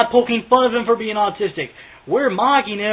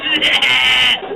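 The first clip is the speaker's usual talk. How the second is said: laugh; 3 semitones higher, 4 dB louder than usual. Real, not acted. Laughs mid-song.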